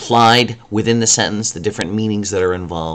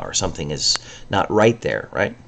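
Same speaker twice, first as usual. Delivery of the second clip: ordinary talk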